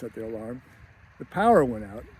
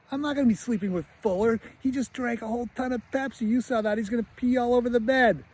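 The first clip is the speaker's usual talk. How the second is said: high-pitched imitation of an indignant boy; protesting kid; 9 semitones higher than usual. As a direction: object like a kid arguing with his mom. Boy refuses to share bed.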